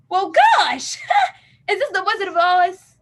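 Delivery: half-laughing gosh of wonder; amazed laughing quip. Retold or imitated. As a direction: marvel at the sudden silence. Everyone silenced by Miss Joni.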